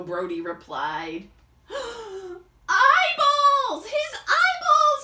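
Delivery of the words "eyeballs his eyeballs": much higher, loud shriek; screaming in fright; horrified shout. Acted. B B sees eyeballs.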